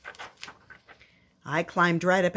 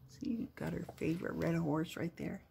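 whispered aside; 12 dB quieter than usual. Real, not acted. A parent whispering to the little listener.

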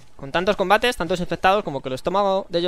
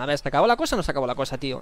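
rattled off fast; rushed impatient; 4 semitones lower, much faster than usual. Real, not acted. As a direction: spit it out impatiently. Impatient at endless fight.

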